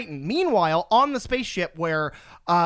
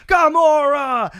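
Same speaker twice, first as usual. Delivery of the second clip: long, loud, high cry of a name; drawn-out high yell, falling at the end; mock anguished shout; 7 semitones higher, 8 dB louder, much slower than usual. They cry out for captured Gamora, mock anguished shout.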